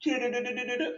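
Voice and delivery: rapid rolled gurgle, held high; imitated pouring sound. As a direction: mimic oil glugging out. Imitates oil pouring into vessels.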